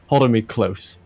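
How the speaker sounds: ordinary delivery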